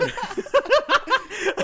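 big high burst of laughter; loud high laugh. Real, not acted. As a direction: crack up loudly. Laughing at own joke.

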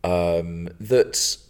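ordinary talk, nothing marked

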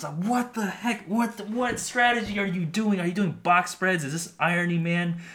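high, loud, disbelieving outburst; incredulous. Real, not acted. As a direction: blurt it out in total disbelief. Exasperated rant at scammers.

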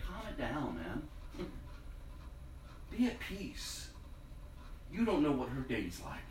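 soft, low and calm, reassuring; gentle voice of the Lord. Retold or imitated. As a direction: let it come like a quiet inner voice of peace. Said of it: "I just felt the Lord say".

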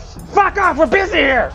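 very high, loud brush-off yell; irritated shouting. Acted. Busy worker to an interrupting outsider, high irritated shout. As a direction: shout them away at full voice. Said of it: "they're all shouting constantly".

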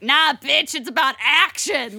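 sassy retort. Retold or imitated. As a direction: swat the idea away loudly. Harriet dismissing reformers.

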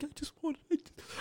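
high, faint pretend whimper; put-on weepy squeak; mock crying. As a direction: imitate a tearful, desperate man, barely audible. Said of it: "cries in his car".

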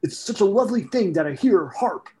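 high-pitched goofy celebrity impersonation; high, swooping impersonation; Andy Samberg. Acted. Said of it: "I do an Andy Samberg impersonation".

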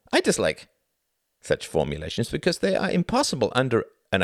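high, emphatic, doctrinaire pronouncement; unyielding zealot. Acted. Klaus answers Tom's challenge on refusal, unyielding. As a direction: lay down the doctrine without wavering.

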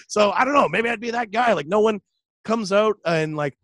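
usual speaking voice

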